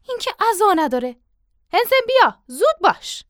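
high, loud hurry-up order; impatient call. Acted. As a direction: call him over briskly, pitched high. Father hurrying the servant along.

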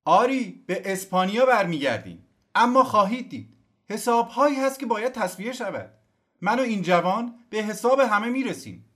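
high, loud rousing speech; old general's fiery speech to the exiles. Acted. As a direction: rouse the crowd with passion. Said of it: "سخنرانی پرشوری".

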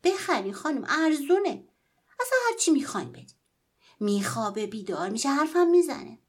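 high, loud, fast pleading; boy chases the woman, begging her to buy the doll; eager pleading. Acted. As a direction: beg her to buy, rushing.

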